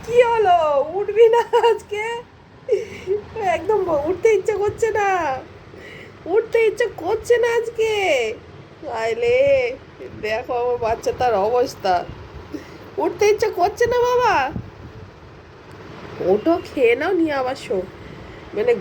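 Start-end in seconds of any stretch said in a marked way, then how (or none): 0.0-2.0 high, loud call to a sleepy pet; raised, coaxing wake-up call. Real, not acted. Calling the sleeping pet awake. 3.6-7.9 high, lightly voiced sleepy pet; pitched-up pet voice saying he won't get up; voicing the pet. Voicing the sleepy pet's thoughts. 13.0-14.3 high, lightly voiced sleepy pet; pitched-up pet voice saying he won't get up; voicing the pet.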